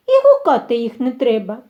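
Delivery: ordinary delivery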